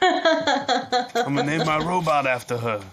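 raider voice. Retold or imitated. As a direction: gush like a dopey raider. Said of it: "maybe some raider was like".

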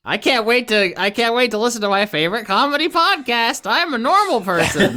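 high, chirpy listener parody; mocking a podcast listener. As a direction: play a smug normal fan. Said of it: "That's how they sound".

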